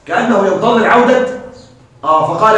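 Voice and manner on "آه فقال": ordinary delivery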